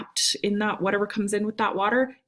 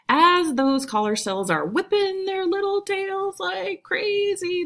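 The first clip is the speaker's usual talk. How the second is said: excited; 12 semitones higher than usual. Real, not acted. Excited description of whipping tails.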